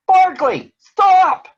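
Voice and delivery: loud, high alarmed shout; yelled. A narrator's reading. Big Bird yelling at Barkley on the chair, loud shout. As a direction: yell to stop the puppy. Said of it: "Big Bird yelled".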